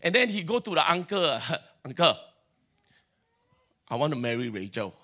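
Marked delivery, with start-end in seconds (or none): 1.8-4.9 low quiet voice. Acted. Jacob asks uncle for Rachel.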